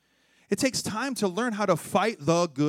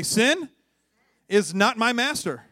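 raised, slow declaration for repeating; leading call-out; 6 semitones higher, 6 dB louder, slower than usual. Shown as a demonstration. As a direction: lead the congregation in a declaration. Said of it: "Just say this with me".

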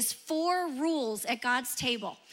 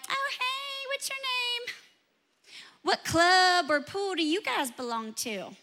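put-on high, sugary mean-girl voice, much higher; snooty girls; 4 semitones higher than usual. Mimicking the middle school girls who snubbed her, high and sugary. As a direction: mimic the prissy country-club girls.

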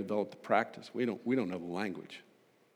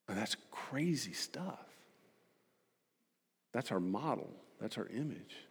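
whisper; 9 dB quieter than usual. Real, not acted. Awed aside in sermon.

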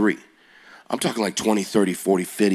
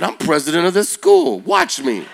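confident boast; 6 semitones higher, 6 dB louder than usual. Retold or imitated. Bold retort to classmates.

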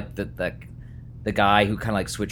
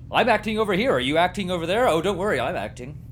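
high, pleased-with-himself actor; mock a self-absorbed actor; smug actor voice; 7 semitones higher, 3 dB louder, faster than usual. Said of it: "acting in a void".

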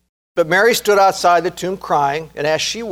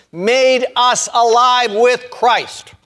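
each word drawn out, pitched higher; slow, emphatic; 6 semitones higher, much slower than usual. Real, not acted. Preacher reads a verse for listeners to mark, slow and emphatic. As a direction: hammer the verse home word by word, slowly.

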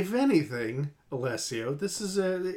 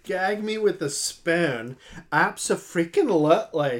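put-on Valley Girl accent. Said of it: "Valley Girl".

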